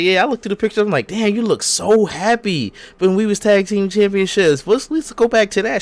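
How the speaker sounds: high-pitched, put-on wistful gushing; mock reminiscing friend. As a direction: imitate a woman coaxing her old friend. Voicing wrestler pleading with former friend.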